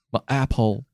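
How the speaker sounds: "My apple" said with the tongue held so it slurs; holding tongue. Pretend. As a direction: hold your tongue and say "My apple". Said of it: "Why are you holding your tongue".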